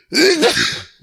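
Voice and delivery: sudden high-pitched sneeze; real sneeze. Real, not acted. Podcaster caught mid-recording by a live sneeze, sneezing. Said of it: "got a live sneeze".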